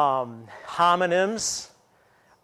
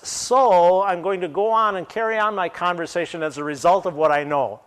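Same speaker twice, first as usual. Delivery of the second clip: normal talking voice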